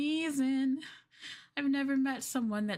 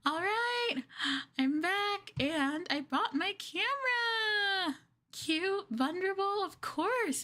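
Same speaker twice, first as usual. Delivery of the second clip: higher, delighted gushing; thrilled; 9 semitones higher than usual. Acted. A girlfriend back with her camera, thrilled. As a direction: savour each word with glee.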